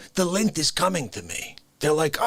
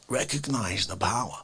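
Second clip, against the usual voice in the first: whisper; 7 semitones lower, 6 dB quieter than usual. Acted. Comic rant.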